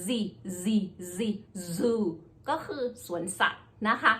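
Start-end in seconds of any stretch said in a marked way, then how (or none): 0.1-1.2 "Z" with teeth together and a buzzing z sound. Shown as a demonstration. Teacher demonstrating the "Z" buzz to learners. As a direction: buzz the "Z" through closed teeth. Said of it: "แล้วก็ออกเสียง".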